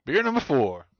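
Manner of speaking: announcer voice. Pretend. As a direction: announce it like a show host. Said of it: "You can use that as a soundbite".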